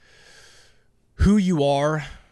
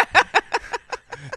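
laughter; 5 dB louder than usual. Real, not acted. Laughs at spelling lessons jibe.

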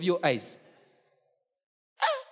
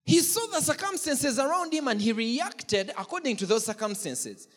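ordinary talk

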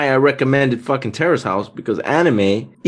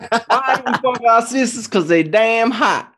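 hammed-up Southern accent from Alabama; 7 semitones higher than usual. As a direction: declare it as a caricature Alabama Southerner. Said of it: "I'm from Alabama".

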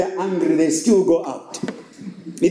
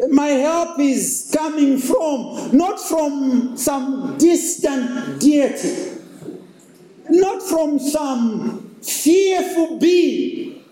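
higher and slower, swelling with each "not"; building fervour; 10 semitones higher, much slower than usual. Acted. Pastor preaching to the congregation, rising fervour. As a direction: lift the congregation, stretching every line.

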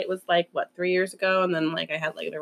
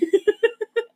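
giggle; 13 semitones higher than usual. Real, not acted. Laughing at the story.

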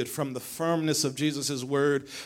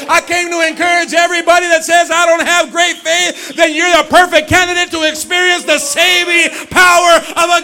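loud, high, ringing preacher's cry; full-force preaching; 12 semitones higher, 17 dB louder than usual. Acted. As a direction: preach it out to the whole church.

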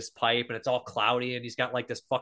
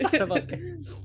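mock Italian curse; 13 semitones higher than usual. Friends joking about Fulci, laughing mock Italian curse. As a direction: spit out the curse in a hammed-up Italian.

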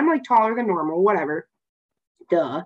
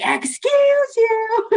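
high, drawn-out mock "excuse you"; sassy, squealed reproach; indignant call-out; 14 semitones higher, much slower than usual. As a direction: stretch it out high in exaggerated affront. Acting out confronting people who shoved them.

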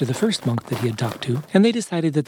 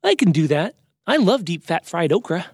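higher, keen character voice; upbeat storybook bear, pitched up; cheerful hungry bear; 4 semitones higher than usual. Acted. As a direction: relish every favourite food. Bobo voiced by narrator.

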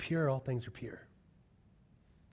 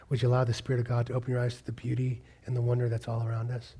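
ordinary delivery

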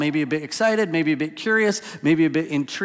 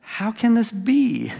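slow, high, wide-eyed wonder; drawn-out puzzled question, higher; a lightly voiced, marvelling Nicodemus; 8 semitones higher, slower than usual. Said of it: "filled with a little bit of wonder".

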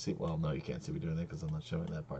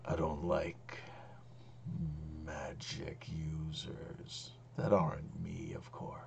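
slow, whispery dragon voice; menacing dragon; 2 semitones lower, 7 dB quieter, slower than usual. Acted. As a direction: draw it out in a soft, sinister whisper. Game master voices the menacing dragon.

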